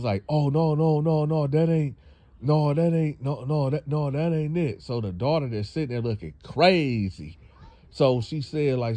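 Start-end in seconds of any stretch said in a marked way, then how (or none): 0.3-4.8 frantic denial. Retold or imitated. Man caught, frantic denial.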